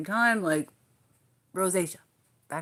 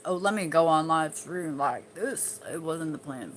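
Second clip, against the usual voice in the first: usual speaking voice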